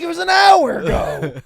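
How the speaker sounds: high, loud, slow, laughing through it; laughing retort. Retold or imitated. Telling his drunk friend the keg was tapped long ago, laughing. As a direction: laugh out the reply. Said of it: "I was like".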